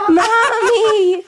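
high, drawn-out wail for mommy; hammed-up tearful whine; mock crying. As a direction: mimic a big baby bawling. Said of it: "so he's crying".

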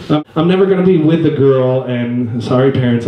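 ordinary talk, nothing marked

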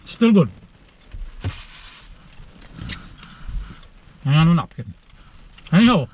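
talking through a mouthful of turkey; chewing between words, mouth full. Real, not acted. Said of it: "Trying to talk with my mouth full here".

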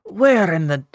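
lightly voiced grumpy Irishman; disgruntled Irish waking voice; Irish accent. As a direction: wake up grumbling in an Irish accent. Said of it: "I don't know why he's Irish now".

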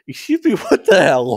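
high, loud shocked outburst; horrified. A narrator's reading. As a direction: blurt it out in mock horror. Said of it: "horrified Stella".

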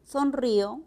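Sonrío, the í stressed, í and o split; sonrío with hiato. Shown as a demonstration. Teacher showing students Sonrío, stressed í. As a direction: say son-rí-o, the í stressed. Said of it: "con hiato acentual".

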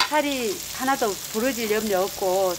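ordinary talk

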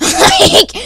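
high burst of real laughter; loud laughter. Real, not acted. Narrator cracking up at his own story: loud laughter.

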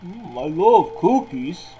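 lightly voiced Santa savouring cookies; a hint of a jolly Santa, slowed; greedy Santa. Gamer voicing Santa finding cookies, light jolly Santa.

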